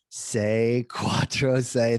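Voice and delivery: very slow, deliberate Italian digits; careful Italian dictation. Retold or imitated. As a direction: spell out each number slowly and carefully. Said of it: "I have to get this right".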